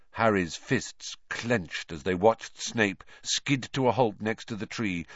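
plain reading voice

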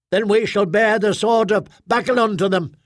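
high, righteous proclamation; threatening squad mother. Acted. Squad mother vowing holy violence on the crowd, threatening.

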